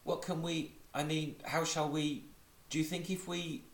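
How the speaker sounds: high, faltering worried Pooh; in distress. Acted. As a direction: stumble over the words, anxious and high. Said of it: "said Pooh in distress".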